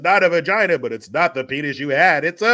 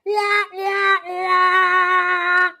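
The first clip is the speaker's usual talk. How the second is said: much higher, put-on crying wah; mock baby wail; 13 semitones higher, 3 dB louder, much slower than usual.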